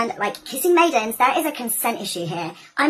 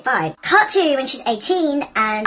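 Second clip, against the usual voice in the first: ordinary talk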